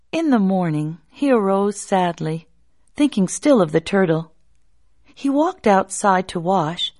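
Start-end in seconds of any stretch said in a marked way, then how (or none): none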